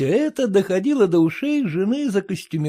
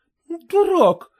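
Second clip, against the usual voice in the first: выше, возмущённый вскрик; вскрикни, вскакивая из-за стола; вскрикнула; 11 semitones higher than usual. A narrator's reading. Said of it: "вскрикнула жена". (жена вскрикивает на мужа в возмущении)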